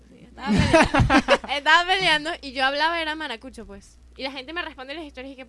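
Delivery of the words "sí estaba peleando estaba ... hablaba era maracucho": bursts out laughing, louder; confessing through laughter. Real, not acted. Guest laughing as she admits she was arguing in a Maracaibo accent.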